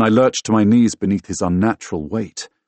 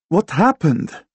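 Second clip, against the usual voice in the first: high, raised-voice question; alarmed question; 12 semitones higher, 5 dB louder, slower than usual. A narrator's reading. Woman asks her friend about escaped ghosts, alarmed questioning. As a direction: press urgently for an answer.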